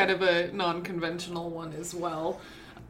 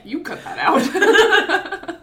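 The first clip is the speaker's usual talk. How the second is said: laughing; 10 semitones higher, 9 dB louder, much slower than usual. Real, not acted. Laughing at friend's joke.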